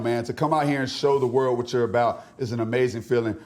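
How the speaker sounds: usual speaking voice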